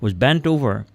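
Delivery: usual speaking voice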